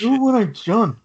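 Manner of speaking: lightly voiced movie line; Napoleon Dynamite quote. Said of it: "Napoleon Dynamite?".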